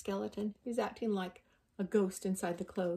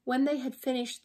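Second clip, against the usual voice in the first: usual speaking voice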